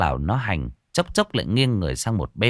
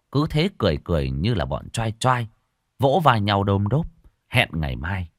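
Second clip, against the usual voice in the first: usual speaking voice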